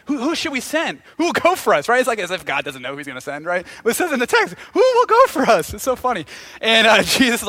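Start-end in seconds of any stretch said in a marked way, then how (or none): 0.1-1.9 higher-pitched, lightly acted God asking for volunteers; eager questioning. God asks the holy huddle who to send, eager questioning. 4.7-5.7 high, loud theatrical call. Acted. God calls for someone to go, loud high call.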